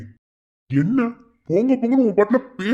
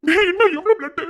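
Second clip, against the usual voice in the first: alarmed; 10 semitones higher, 3 dB louder than usual. Acted. Friend sees blood.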